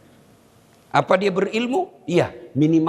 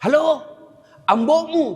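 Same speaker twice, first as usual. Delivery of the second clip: shouting into phone; 9 semitones higher than usual. Retold or imitated. Old man shouting halo into a wartel phone. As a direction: yell down the phone line. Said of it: "Sudah teriak".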